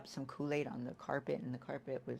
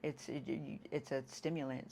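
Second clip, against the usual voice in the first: ordinary delivery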